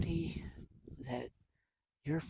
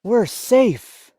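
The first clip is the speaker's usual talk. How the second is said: much higher, louder happy voice; relieved announcement; 9 semitones higher, 12 dB louder than usual. Acted. Stellaluna telling friends they are safe. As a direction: breathe out relief.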